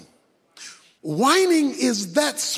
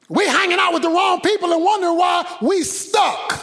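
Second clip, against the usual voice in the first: full-voiced sermon climax; preaching at full force; 9 semitones higher than usual.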